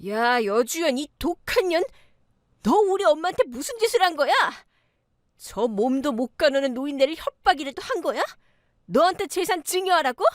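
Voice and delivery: high-pitched, outraged accusation; furious yelling. Acted. Eldest sister-in-law storms in on the daughter-in-law, yelling furiously. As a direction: hurl indignant questions in a high, strained voice. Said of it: "고래고래 소리를 질렀어요".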